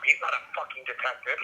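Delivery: ordinary delivery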